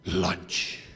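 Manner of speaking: very low crocodile voice; hungry crocodile. Acted. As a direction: growl it like a hungry beast.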